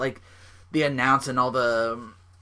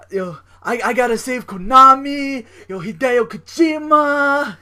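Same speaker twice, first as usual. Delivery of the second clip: loud, very high mock impression, names drawn out; Homicide; 12 semitones higher, 8 dB louder, slower than usual. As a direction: cry out the names in a put-on wrestler voice. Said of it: "best Homicide impression".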